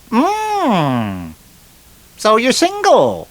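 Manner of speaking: high, drawn-out lady's punchline; lady in a joke. Acted. Lady sizing up a wife killer, high interested lady voice.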